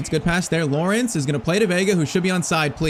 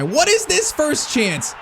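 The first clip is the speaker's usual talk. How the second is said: high, drawn-out incredulous cry; outraged disbelief; 9 semitones higher, slower than usual. Real, not acted. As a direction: stretch it out, aghast at the goal. Opponent scores with first chance.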